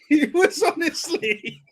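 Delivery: talking through laughter, high and slow; real laughter. Real, not acted. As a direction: crack up while trying to finish. Cracks up after own impression.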